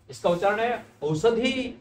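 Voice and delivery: ordinary talk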